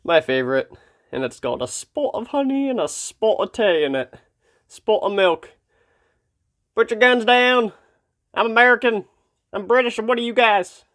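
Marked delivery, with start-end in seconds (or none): 1.1-10.9 higher, hammed-up British accent; mock British accent. Vlogger jokes about his tea in a hammed-up British accent. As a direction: play the posh Brit over tea, higher. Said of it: "so legally I can use this accent".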